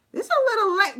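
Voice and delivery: motherly scolding. Retold or imitated. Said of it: "getting all mom on 'em".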